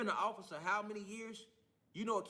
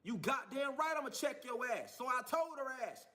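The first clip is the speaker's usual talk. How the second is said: normal talking voice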